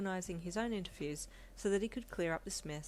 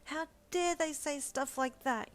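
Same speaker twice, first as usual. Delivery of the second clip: high, slow furious outburst; raging; 9 semitones higher, 4 dB louder, slower than usual. A narrator's reading. Pregnant wife raging at home to husband, high angry outburst. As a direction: explode at the unfair papers. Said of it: "you raged".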